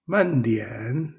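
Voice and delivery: usual speaking voice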